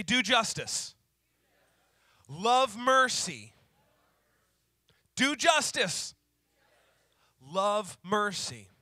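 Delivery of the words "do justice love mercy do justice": preacher calling out each phrase for the room to repeat; call and response. Shown as a demonstration. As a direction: proclaim each phrase slowly and firmly.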